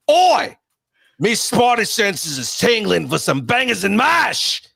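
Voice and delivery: intentionally bad Cockney accent, high and slow; bad British accent. Shown as a demonstration. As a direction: ham up a terrible London accent. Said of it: "I'm gonna do this with an intentionally bad accent".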